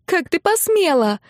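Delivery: high-pitched angry accusation; furious yelling. A narrator's reading. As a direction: hurl it at them in outrage. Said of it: "заорала Нелли".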